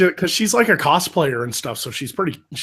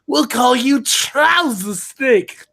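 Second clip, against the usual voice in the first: slow, put-on cartoon villain; slow smug Cobra Commander voice; 5 semitones higher, 3 dB louder, slower than usual. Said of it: "Commander Cobra's like".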